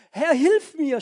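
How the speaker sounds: a loud, high cry for help; desperate shout. A narrator's reading. Peter sinking in the storm, shouting to the Lord for help. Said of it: "schrie er".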